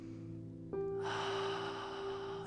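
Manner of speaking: long, slow, soft breath out; cleansing deep breath. Shown as a demonstration. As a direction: let out a deep, relaxing sigh. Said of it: "let's just take a nice, long, cleansing, deep breath".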